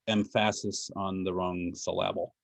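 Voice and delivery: "emphasis" and "syllable" each stressed on the middle syllable; deliberately misplace the stress: em-PHA-sis, syl-LA-ble; deadpan joke, "emphasis" and "syllable" stressed on their second syllables; joking wrong stress. Shown as a demonstration. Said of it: "Everybody get that joke?".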